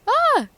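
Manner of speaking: sudden high, loud overwhelmed cry; a sharp cry as the feeling spills over; real emotional cry. Real, not acted. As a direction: let a burst of tears break out. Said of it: "why am I getting so emotional".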